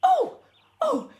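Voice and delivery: high, shrill-free startled cries; startled fright. Acted. Mother startled by soldiers at the door, frightened cry. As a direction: jump out of your skin.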